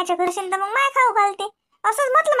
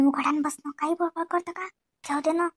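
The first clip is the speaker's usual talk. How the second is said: hushed scolding; 2 semitones lower, 8 dB quieter than usual. Acted. Husband quietly hushing his mother, much softer. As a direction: shush her under your breath. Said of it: "मला म्हणता मुकाटानं बस ना".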